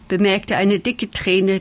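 usual speaking voice

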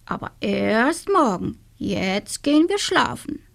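a hint of a rooster, firm but kind; the rooster putting everyone to bed; lightly voiced rooster. Rooster Rudi decides. (angedeuteter Hahn, bestimmt)

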